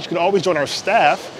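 ordinary delivery

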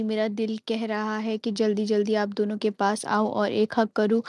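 ordinary talk, nothing marked

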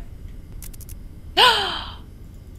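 high, long gasp; startled gasp. Real, not acted. Player startled mid-game, high gasp. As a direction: suck in a sharp, startled breath.

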